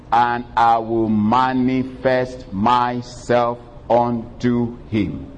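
slow, deliberate quoting; emphasis. Shown as a demonstration. Pastor re-quoting the promise to the church, slow emphasis. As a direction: give every word its weight. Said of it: "I want to emphasize that last part".

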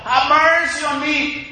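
high, pleading cry for mercy; a preacher's voiced plea, high and carrying. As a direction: cry it out like the blind beggar. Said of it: "cried out".

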